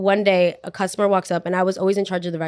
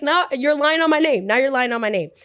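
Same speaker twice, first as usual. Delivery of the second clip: indignant protest; 6 semitones higher than usual. Retold or imitated. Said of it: "I was like".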